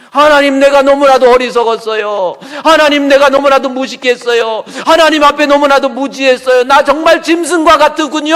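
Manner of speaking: loud, high sustained lament; preacher voicing Asaph, loud and high; repentant cry. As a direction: pour out your shame to God.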